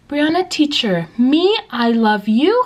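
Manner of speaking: put-on high child's voice ending in a question; slow, high, questioning kid voice; imitating young student. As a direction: mimic a pupil asking, drawn out and rising. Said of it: "raise their hand in the middle of my lesson".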